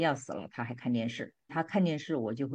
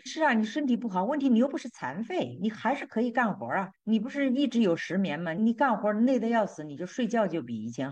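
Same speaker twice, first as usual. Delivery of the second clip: higher and louder, scolding her excuses; chiding rebuke; 8 semitones higher, 4 dB louder than usual. Retold or imitated. God confronting a complaining wife, higher and loud chiding. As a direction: press her hard, leave no excuse standing.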